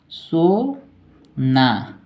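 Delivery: सोना stretched very slowly; drawn out dictation. Shown as a demonstration. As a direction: let सोना linger for students to write. Teacher dictating word slowly. (बहुत धीरे खींचकर)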